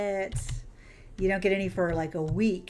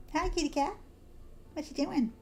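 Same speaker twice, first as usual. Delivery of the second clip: pet talk; 8 semitones higher, 5 dB quieter than usual. Real, not acted. Streamer cooing softly at the game cat, high baby talk.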